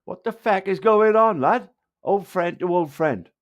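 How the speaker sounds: high-pitched, worked-up mimicry of another man; imitating Taft. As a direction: read his message out as an exasperated appeal. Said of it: "this is what Taft is saying".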